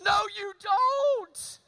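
mock shouting at the crying girl; exasperated outburst. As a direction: blurt it out half-laughing in disbelief. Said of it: "all I wanted to say is".

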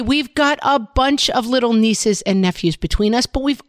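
upbeat sponsor read, loud and bright. Acted. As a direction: sell it like a radio ad.

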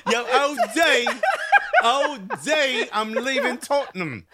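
high laughing mock Bronx voice; Bronx accent. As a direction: call it like a Bronx homie. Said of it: "Bronx Harry Kane".

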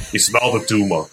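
thick Austrian movie-quote impression; Arnold Schwarzenegger. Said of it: "five different Arnold movies now".

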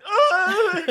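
high, teary overwhelmed gush; touched. Retold or imitated. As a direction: melt over a kid's note. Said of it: "And I'm just like".

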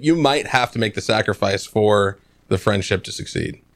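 normal talking voice

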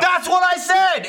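high, emphatic retold protest; exasperated outburst. Retelling the argument over kids, exasperated outburst. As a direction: burst out as if vindicated, voice jumping way up.

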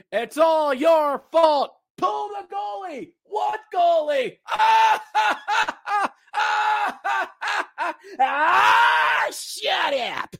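fed-up fan shouting back; frustrated yelling. Real, not acted. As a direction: yell at them in exasperation. Fan raging at the crowd.

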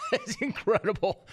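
laughing through the words; can't stop laughing. Real, not acted. Laughing at the golf story.

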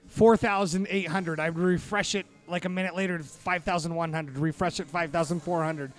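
ordinary delivery

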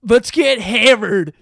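put-on loud, slow drunk bro voice; rowdy party animal. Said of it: "That voice".

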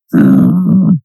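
loud, low disappointed grunt; rumbling mock cartoon-wife grumble; Marge Simpson. Friend imitating Marge annoyed at Homer, low loud grunt. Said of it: "Marge Simpson's disappointed grunt".

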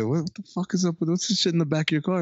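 high, fast mock demand; cop interrogation. Cops questioning the driver, fast high mock demand. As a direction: fire it off in a put-on voice. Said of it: "they say".

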